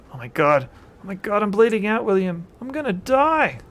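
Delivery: loud, high panicked wail. Acted. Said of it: "Frank Bates wails".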